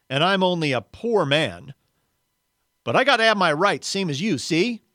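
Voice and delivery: loud, high-pitched, argumentative outburst; belligerent, aggrieved character voice; indignant ghost. Acted. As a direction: argue back, insisting on your rights. Reads the arguing ghost's part.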